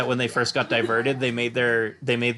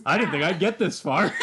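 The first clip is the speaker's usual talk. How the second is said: playful character voice; 5 semitones higher than usual. Acted. Voicing the character.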